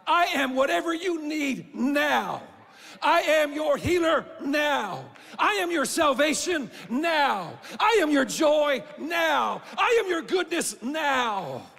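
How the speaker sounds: slow, loud, ringing sermon delivery; preaching at full force. Acted. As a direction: thunder out each line from the pulpit. God's words preached at full force.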